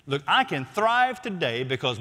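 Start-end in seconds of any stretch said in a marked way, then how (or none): none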